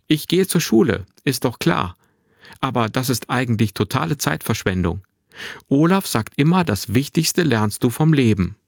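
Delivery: put-on high, lively boy, sure of himself; cheeky young boy. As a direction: talk like a self-assured twelve-year-old. Narrator voices the boy Finn.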